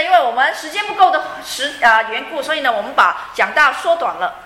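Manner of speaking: usual speaking voice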